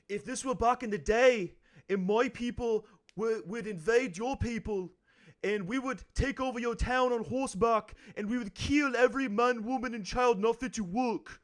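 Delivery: put-on high Irish accent, cocky; Conor McGregor impression. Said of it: "one specific Conor McGregor line".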